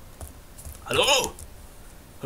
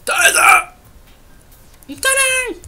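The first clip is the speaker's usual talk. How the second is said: triumphant call; 9 dB louder, slower than usual. Real, not acted. Finding the target, triumphant call.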